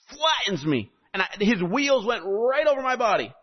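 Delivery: a panting kid telling his pastor a miracle, mildly acted; young man bursting into church to tell pastor, breathless and high; breathless excited young man. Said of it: "walks into church sweating and panting and out of breath".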